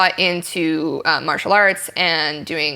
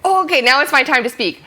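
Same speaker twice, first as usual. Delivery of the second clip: mock-startled, higher-pitched catch of the cue; sudden realization; 7 semitones higher than usual. Actor noticing their cue has come, mock surprise. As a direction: realize it's suddenly your turn.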